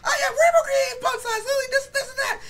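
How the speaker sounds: very high, loud squeaky cartoon voice; mock your old voice as a shrieking squeak; Mickey Mouse. Roaster mimicking his unmasculine old battle voice, squeaky and emotional. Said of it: "Imagine Mickey Mouse saying that shit".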